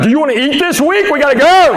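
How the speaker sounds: high, mock-frantic plea rising to a yell; acted-out yell to get moving; exasperated urging. Acted argument builds to exasperated yell.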